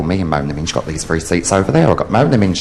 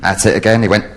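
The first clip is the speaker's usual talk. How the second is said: ordinary talk, nothing marked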